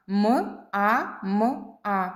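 М, А, М, А as four separate drawn-out sounds; slow phonics breakdown of М А М А. Shown as a demonstration. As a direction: sound out М А М А slowly, one sound at a time. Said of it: "Ось така схема слова мама".

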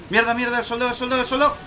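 high, frantic, climbing pitch; panicked shouting. Real, not acted. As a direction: panic for the soldier. Intenta salvar al soldado. (gritando con pánico)

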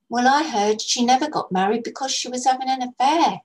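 put-on a young girl sharing a juicy secret; gossiping girl. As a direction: spill the gossip eagerly. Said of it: "Letty began".

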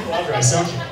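ordinary talk

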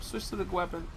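ordinary talk, nothing marked

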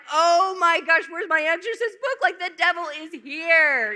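loud, high mock panic; imitating panicked priest. Priest imagined thinking the devil is here, mock panic. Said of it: "He'd have been like".

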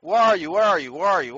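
ordinary delivery

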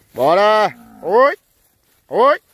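high, loud herding yells; loud calls to push the herd along; cattle calls. Real, not acted. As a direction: holler to drive the cows on. Herding cows into the corral.

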